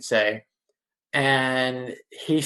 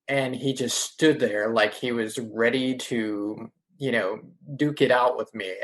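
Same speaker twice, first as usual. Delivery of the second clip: ordinary talk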